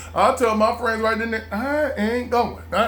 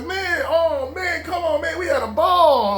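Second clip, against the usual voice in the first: higher, excited friend bragging about the party; 7 semitones higher than usual. Retold or imitated. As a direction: rub it in like a buddy on the phone.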